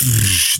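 mouthed shotgun noise, long "shh"; hissy vocal gunshot effect; imitated gun blast. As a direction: make a blast sound with the mouth.

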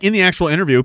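normal talking voice

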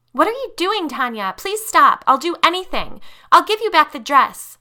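very high, loud, frantic pleas; panicked begging. Acted. Lisa begging Tanya to stop the speeding limo, panicked. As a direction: beg her to stop the car.

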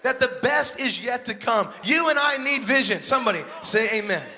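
loud, rousing preacher's call to the crowd; full-force preaching. Acted. Preacher rallying his congregation, loud and fervent.

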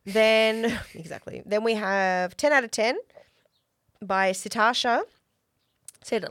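ordinary delivery

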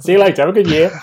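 mock sign-off. Jokingly ending the show early.

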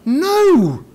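high shouted "No!"; mock answer. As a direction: answer for them in a put-on voice.

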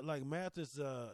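ordinary talk

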